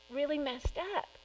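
normal talking voice

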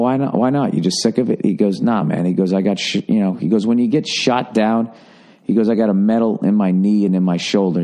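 ordinary talk

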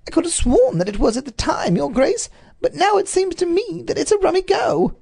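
a nervous servant explaining to his master. A narrator's reading. A servant nervously answering the Duke.

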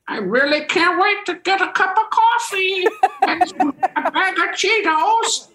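high, slow goofy Sandler voice; imitating Adam Sandler. Classmate quoting Adam in class, goofy high voice. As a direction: squeak it out like a silly kid. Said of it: "he would say things like".

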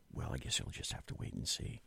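whispered playful aside; teasing whisper. Real, not acted. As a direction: hush it like a secret. Teasing aside about Thursday.

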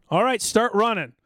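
high, slow called-out order; imitated sergeant's command. Veteran imitating a sergeant starting the run, mock commanding. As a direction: call it out to a troop of recruits.

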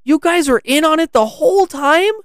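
put-on very high, loud kid voice of disbelief; incredulous high-pitched outburst; shocked. A narrator's reading. Lance learns they planned it all, shocked. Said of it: "Lance was shocked".

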